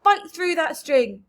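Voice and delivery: loud, pressing order; urgent yell. A narrator's reading. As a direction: urge him on loudly. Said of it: "yelled James".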